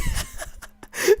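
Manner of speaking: airy high squeal of laughter; breathless high giggle. Real, not acted. Gamer laughing at his old excuse, airy high giggle. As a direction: wheeze with laughter.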